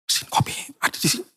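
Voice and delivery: hushed secret aside; a quick secret whisper to the wife. Retold or imitated. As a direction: whisper it so the host won't hear. Secretly telling wife about machine.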